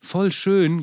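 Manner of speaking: „Voll schön.“ deutlich höher und lauter, „schön“ fallend; „Voll schön“ begeistert ausrufen wie Jugendliche; jugendsprachlich betont. Shown as a demonstration. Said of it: "ganz anders betont". („Voll schön.“ hoch und begeistert)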